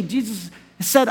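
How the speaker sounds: normal talking voice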